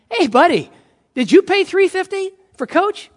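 acted-out grievance, voice pushed up; indignant passenger. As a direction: demand an answer from a fellow passenger. Indignant passenger confronts the lucky one.

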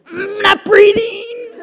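high, loud, slow mock wail; little girl refusing the teacher, hammed-up wail; defiant child shout. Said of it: "And she went".

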